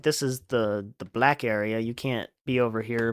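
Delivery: usual speaking voice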